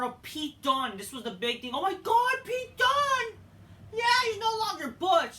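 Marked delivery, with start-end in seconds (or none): 1.7-3.4 high, loud fanboy yell; over-the-top high-pitched cheer; mock excitement. Mock-excited reaction to a return. 3.9-5.4 high, loud mock cheer; hammed-up high shout; mock celebration. Mock celebration of the name change.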